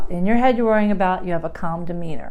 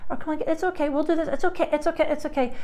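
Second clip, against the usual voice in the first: soft, high, pleading reassurance; the overly talky parent soothing; mock anxious reassuring, softer and higher; over-soothing chatter; 7 semitones higher, 5 dB quieter, faster than usual. Shown as a demonstration. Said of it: "don't be overly talky".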